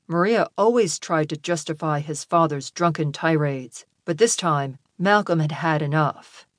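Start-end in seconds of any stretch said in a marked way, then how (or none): none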